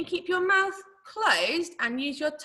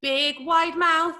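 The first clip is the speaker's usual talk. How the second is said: ordinary delivery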